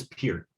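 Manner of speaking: "[name]" sounding like "peer" plus a t; pronounced PEERT. Shown as a demonstration. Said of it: "I believe it's [name]".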